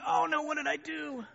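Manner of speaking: high, thin-voiced dismay; mock panic. Guilty driver realising his mistake, mock panicked. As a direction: wail it in exaggerated guilt.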